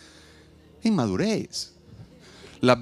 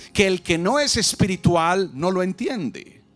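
ordinary talk

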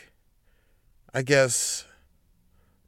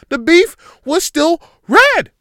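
high, loud outraged cry; outraged shout; 13 semitones higher, 10 dB louder, slower than usual. Real, not acted. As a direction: yell it in disbelief. Outraged rant about raw beef.

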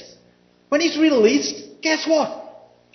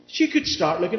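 ordinary talk

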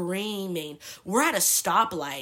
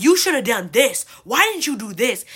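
loud, higher-pitched scolding advice; indignant urging, imitated; 4 semitones higher, 9 dB louder than usual. Girls telling her how she should have reacted, acted indignation. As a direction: push her hard, acting the outraged friend.

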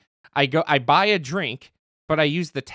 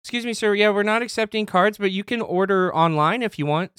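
lightly voiced polite cashier, higher; mock cashier voice; 7 semitones higher than usual. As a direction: play the apologetic clerk, a touch higher. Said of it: "they're like".

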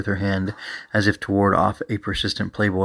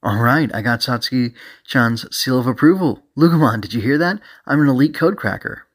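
higher, gleeful showing off; excited boasting; 4 semitones higher, 4 dB louder than usual. Acted. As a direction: brag to your partner, thrilled. Character boasts of being approved.

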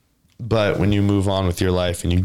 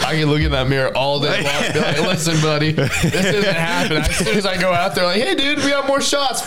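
higher, laughing through the words; laughing retelling; 8 semitones higher than usual. Retold or imitated. Friend laughing while telling podcast host about drinking. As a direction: chuckle through the story.

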